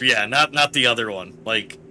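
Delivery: ordinary talk, nothing marked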